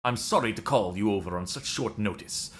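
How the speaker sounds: put-on hammy French accent reading a mission briefing; over-the-top French accent, fully committed. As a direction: play it like a French Resistance handler. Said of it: "This is my French accent".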